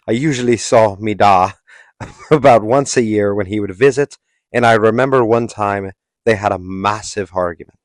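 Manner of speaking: broad, hammed-up British accent; Andrew Tate. Recounting Andrew Tate's boyhood haircut story, mock British accent. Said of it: "I'm gonna stop doing the accent now".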